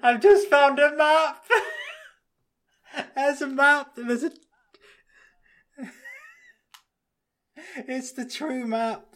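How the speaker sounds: high, laughing excitement; excited. Real, not acted. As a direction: blurt it out thrilled. Excited discovery mid conspiracy chat.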